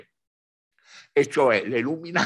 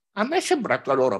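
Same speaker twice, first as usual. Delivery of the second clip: ordinary talk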